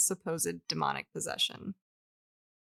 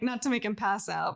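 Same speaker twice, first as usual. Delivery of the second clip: normal talking voice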